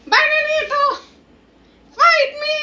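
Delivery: high challenging shout; child's battle cry; kid shout. Acted. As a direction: call him out to fight, high and loud. Kid challenges Bananito in roleplay.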